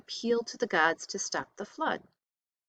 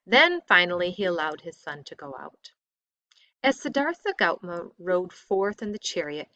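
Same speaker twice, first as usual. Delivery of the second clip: ordinary delivery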